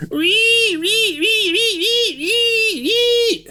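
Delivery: very high, rapid repeated squeals; piercingly high animal distress squeal; squealing attacked bunny. Retold or imitated. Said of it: "like a little bunny getting attacked".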